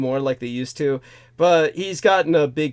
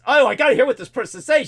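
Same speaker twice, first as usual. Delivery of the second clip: fast, higher, lightly voiced eager fool; mocking eager listener; 8 semitones higher, 4 dB louder, faster than usual. Friend hearing a British accent on the radio, lightly mocked eagerness. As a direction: rattle it off like a dazzled fan. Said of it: "this guy just starts".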